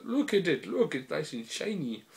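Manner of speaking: high-pitched teasing elite voice; taunting show-off. Acted. Acts elite showing off sword.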